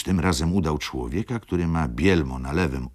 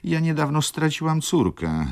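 pleading baroness, a touch of tears, pitched up; tearful plea; 8 semitones higher than usual. A narrator's reading. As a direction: plead as if close to tears, voice rising. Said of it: "zakończyła ze łzami". (błagalnie, z lekkim płaczem)

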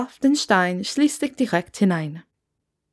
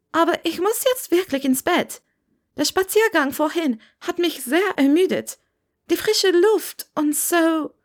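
much higher, tired polite excuse; weary Hermine slipping away; higher young Hermine; 9 semitones higher than usual. Acted. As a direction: beg off politely after hours of stories. Hermine excuses herself to bed.

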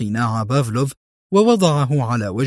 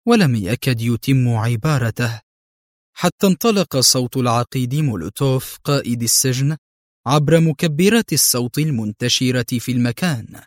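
ordinary delivery

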